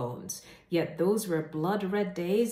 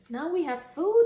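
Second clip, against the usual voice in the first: much higher, louder happy exclaim; hungry animals finally seeing food, higher and louder; 11 semitones higher, 5 dB louder than usual. Acted. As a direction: burst out with joy. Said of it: "exclaimed the animals".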